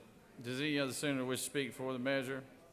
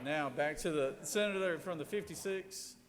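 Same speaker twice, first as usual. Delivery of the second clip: usual speaking voice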